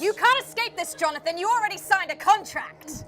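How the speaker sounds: high, loud villainous declaration; domineering threat. Acted. Cult leader warns captive of signed contract, loud threat. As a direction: raise your voice to trap them.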